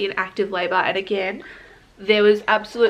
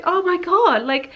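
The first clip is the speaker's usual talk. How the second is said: high and loud, amused at herself; giddy disbelief; 5 semitones higher, 5 dB louder than usual. Retold or imitated. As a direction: laugh off the ordeal, wide-eyed. Marvels at her own wailing.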